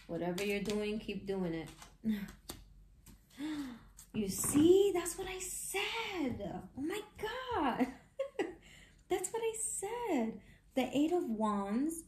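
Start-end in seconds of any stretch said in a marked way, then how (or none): 4.1-12.0 high, laughing told-you-so; thrilled squeal. Real, not acted. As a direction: shriek with delight at being right. Thrilled card confirms prediction.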